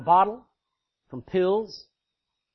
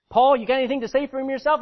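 louder, faster taunting king; mocking king; 7 semitones higher, 9 dB louder, faster than usual. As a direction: sneer down at the prisoner. King addresses chained Paul.

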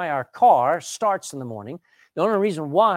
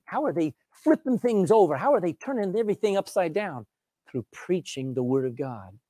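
ordinary talk, nothing marked

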